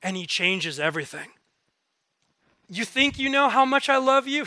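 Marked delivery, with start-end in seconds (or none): 2.7-4.4 high, louder mock-incredulous question; playful teasing. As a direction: tease them as if they have no idea. God playfully teasing believers.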